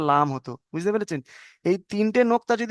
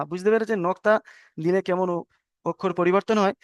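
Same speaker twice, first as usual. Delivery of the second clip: ordinary delivery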